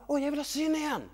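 high-pitched, longing cry; eager plea. Acted. Blind man begging Jesus for sight, high eager plea.

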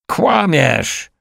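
high, drawn-out angry cry; roared accusation. A narrator's reading. Accused man roaring at his accuser, high-pitched outburst. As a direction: roar it out without much volume. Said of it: "Ryknął".

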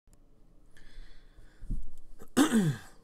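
loud throat clear. Real, not acted. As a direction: clear your throat loudly. Clears throat before speaking.

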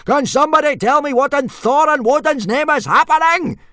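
high, loud bellow of outrage; going ballistic. A narrator's reading. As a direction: roar at the whole chaotic crowd. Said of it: "Gobber the Belch went ballistic".

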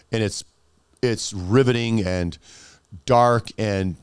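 usual speaking voice

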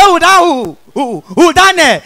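comic stammerer blurting his way to a word; hooting false starts, then the word breaks free; stammering. Acted. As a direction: act out a chronic stammer. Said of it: "the first stammerer come dey answer am".

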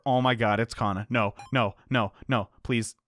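ordinary talk

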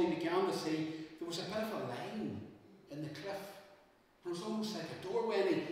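ordinary delivery, nothing marked

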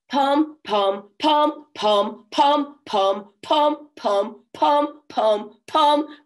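loud, high brassy pom-poms. Acted. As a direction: blast it out like a horn. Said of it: "He's got to pom pom really loud".

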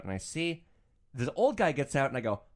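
usual speaking voice

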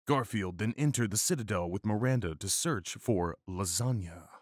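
usual speaking voice

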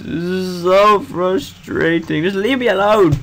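frantic laughing frustration. Real, not acted.

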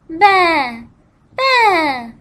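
high bleating baa, baa; sheep bleat. Shown as a demonstration. Teacher showing kids a sheep, bleating baa. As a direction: bleat like a lamb for the children. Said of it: "A sheep says".

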